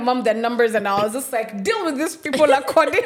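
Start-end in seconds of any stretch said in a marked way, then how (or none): 1.6-3.0 raised, louder, amused order; laughing mock command. Telling mom to handle the management, laughing command. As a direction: break into laughter giving the order.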